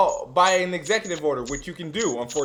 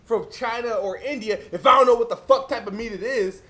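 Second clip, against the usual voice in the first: normal talking voice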